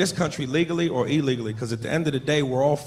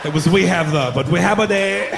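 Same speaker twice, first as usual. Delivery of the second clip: put-on halting Mexican accent; slower than usual. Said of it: "He said".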